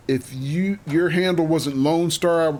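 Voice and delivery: ordinary talk, nothing marked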